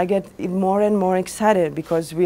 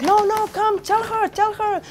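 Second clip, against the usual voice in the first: put-on much higher, childish insisting voice; like a small kid; 13 semitones higher than usual. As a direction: beg like an excited little kid. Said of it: "he was like a small kid".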